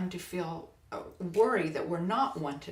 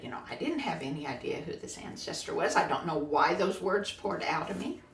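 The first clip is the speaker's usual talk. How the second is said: ordinary talk, nothing marked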